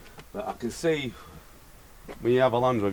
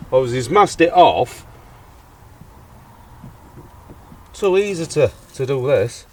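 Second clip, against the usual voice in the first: loud, fired-up rant about shoddy work; loud, incredulous ranting to camera; exasperated rant; 11 dB louder than usual. Real, not acted. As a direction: vent loudly at the bad job. Ranting about a sloppy paint job.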